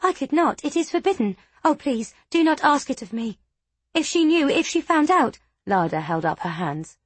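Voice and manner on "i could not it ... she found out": high, panicky pleading; frightened squeak. A narrator's reading. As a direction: plead in rising fear. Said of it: "The girl squeaked in fear".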